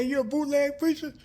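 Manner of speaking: very high-pitched incredulous question; mock challenge. Preacher questioned at granddaddy's funeral, mock challenge.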